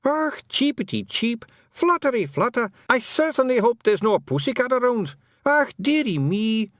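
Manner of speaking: Scots feagle hamming up a hurt bird; feagle yelling. A narrator's reading. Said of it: "it yelled".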